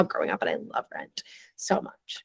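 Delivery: a breathy hush of love; whispering. Real, not acted. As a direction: whisper it like a secret. Gushing about Rent.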